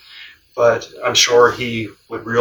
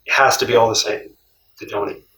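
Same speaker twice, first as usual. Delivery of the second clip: ordinary talk, nothing marked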